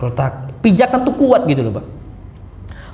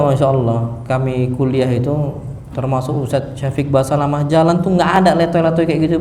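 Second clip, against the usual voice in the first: normal talking voice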